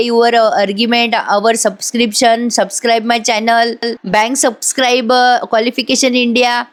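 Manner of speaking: slow, halting English word by word; imitating someone stuck for English; faltering made-up English. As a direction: grope for random English words, dragging them out. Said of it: "पुढचं काही बोलता येईना".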